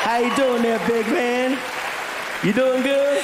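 drawling movie-star impression, projected; Matthew McConaughey. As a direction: greet him in the star's accent. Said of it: "he looks at me and he says".

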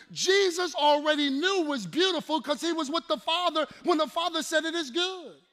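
loud, high commanding quote; preaching at full force. Acted. Preacher thundering Jesus' answer. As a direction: declare it over the crowd.

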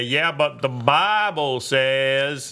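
slow Southern drawl on the Bible line; Southern accent quote in Congress. Acted. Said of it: "Southern accent".

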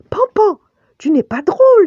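high, loud cry of reproach; exclamation de reproche. A narrator's reading. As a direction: cry out at your friend, very high. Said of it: "s'écria Bambi". (cri aigu et indigné)

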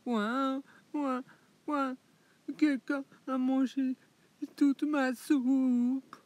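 high mock wailing little bear; crying cub. Le petit ours pleure sa soupe mangée, pleurnichant. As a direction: whimper and wail like a tiny cub. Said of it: "il se met à pleurer".

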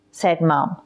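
ordinary delivery, nothing marked

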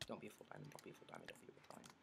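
very soft, repeated under the breath; whispered pleading. Real, not acted. Player hoping opponent lacks full diamond, whispered pleading. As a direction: whisper it like a private wish.

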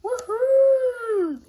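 loud high whoop of delight; joyful holler. A narrator's reading. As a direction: whoop it out with glee. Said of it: "Sarah hollered".

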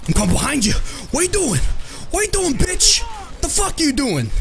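high-pitched trash talk; excited taunting. Real, not acted. Gamer sneaking up behind an opponent, excited taunting. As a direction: jeer at the opponent, voice shooting up.

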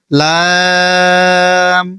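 লাম held long; drawn-out Arabic letter. Shown as a demonstration. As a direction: stretch লাম slowly. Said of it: "চার second". (লাম টেনে)

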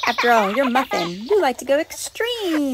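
ordinary talk

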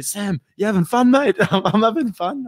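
high, loud teasing call with a laughing reply; imitating a yell. Co-host imitating the commentator's ringside yell, loud and gleeful. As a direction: shout it gleefully at the fighter. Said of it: "I could hear you at one point yelling out".

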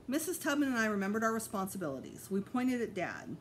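plain reading voice

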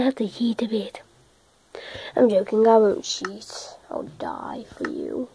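soft breathy aside; whispering. Real, not acted. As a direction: whisper it like a secret.

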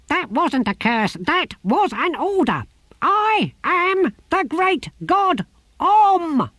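the tortoise god cursing the gardener; furious tortoise curse. A narrator's reading. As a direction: rain down divine wrath. Tortoise proclaims.

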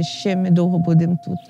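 ordinary delivery

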